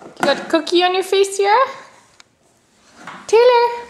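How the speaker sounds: high sing-song coaxing. Real, not acted. Mother coaxing toddler to walk.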